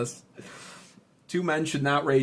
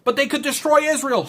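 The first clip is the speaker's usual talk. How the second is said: high-pitched mock alarmist voice; whiny-high caricature of a worrier; mock objector; 11 semitones higher, 3 dB louder than usual. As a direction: parody a panicky objection, pitched way up. Voices objectors he then dismisses.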